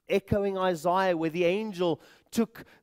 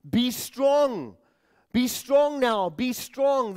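"be strong" driven home, higher and louder; double emphasis; 5 semitones higher, 4 dB louder, slower than usual. Retold or imitated. Preacher relays the command to Daniel, emphatic repetition. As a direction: hammer "be strong" each time, pitched up. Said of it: "This double emphasis".

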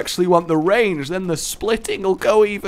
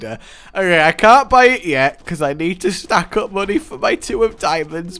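ordinary talk

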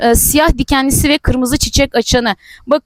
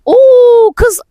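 much higher, louder delighted cry; excited squeal; 9 semitones higher, 6 dB louder than usual. Real, not acted. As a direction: burst out in amazed joy. Thrilled by a huge cactus. (heyecanla yüksek sesli çığlık)

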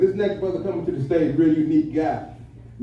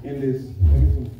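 ordinary talk, nothing marked

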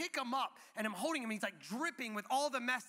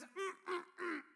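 high, soft strained grunts; imitated toddler straining; 8 semitones higher, 5 dB quieter than usual. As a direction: grunt like a baby filling a diaper. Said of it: "he's making these noises".

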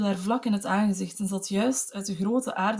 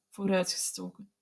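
ordinary delivery

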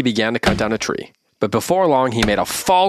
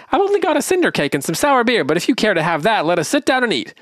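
much higher, simple-lad voice; high Dullhead voice; 11 semitones higher than usual. Acted. Young simpleton sharing, pitched way up. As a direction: Dullhead kindly offers the gray man his cinder cake, in a high, simple-lad voice.

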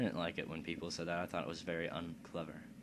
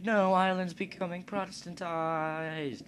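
mock protest; 10 semitones higher, 6 dB louder than usual. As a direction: protest loudly in a hammed-up high voice. Mock protest voiced for a game nation.

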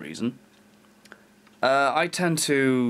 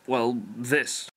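ordinary delivery

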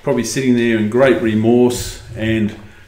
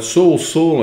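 higher-pitched, restrained narrated cry; 6 semitones higher than usual. A narrator's reading. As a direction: call his name out in mild astonishment. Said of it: "I cried".

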